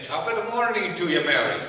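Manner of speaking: jaunty stage-Irish greeting, Irish brogue hammed up. Cy greeting secretary Mary Blades in a mock Irish brogue.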